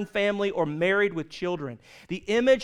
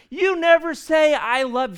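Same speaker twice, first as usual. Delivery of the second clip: nagging complaint; 8 semitones higher, 7 dB louder than usual. Retold or imitated. Wife nagging her husband about love, loud and high. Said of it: "she complained about it all the time".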